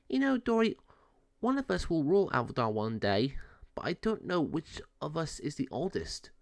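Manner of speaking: wondering aloud to his sibling, only a hint of a character voice; lightly voiced cat Moe. Moe talking with Dory.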